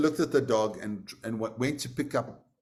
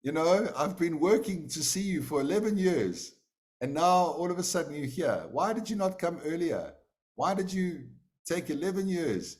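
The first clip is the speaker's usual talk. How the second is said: upset disbelief; 8 semitones higher than usual. Acted. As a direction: demand why it took so long. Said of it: "a little bit upset".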